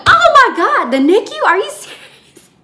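loud, high squeal of disbelief; retold excitement. Nurse learning it's the nicu, wildly excited. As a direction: shriek it like dream news just landed. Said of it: "I was so excited on the phone".

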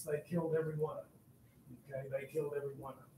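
grave, very soft aside; hushed. Real, not acted. Teacher telling class nobody survived, hushed. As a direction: drop right down, almost under the breath. Grim outcome of the battle.

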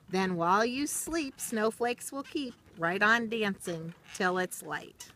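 lilting, rhythmic storybook read; reading to children. Acted. Teacher reading a rhyming book to children, storybook lilt. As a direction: read the rhyme slowly to little listeners, sing-song. Reads picture book aloud.

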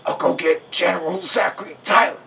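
high, strained rough guard voice; put-on rough character voice. A narrator's reading. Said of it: "in a rough voice".

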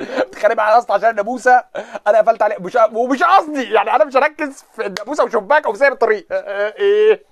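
high, loud, exasperated ranting at the driver; mock heated argument. The driver picks a fight over a mosquito, he rants back high and loud.

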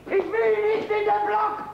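slow, high, pleading; desperate plea. Acted. Peasant begging the king to spare him, high slow plea. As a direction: beg not to be locked up.

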